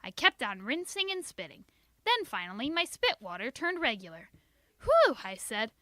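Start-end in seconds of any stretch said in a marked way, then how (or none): 4.8-5.2 big high relieved whew; girl sighing whew in relief; loud whew of relief. A narrator's reading. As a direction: blow out relief. Relief after the scare.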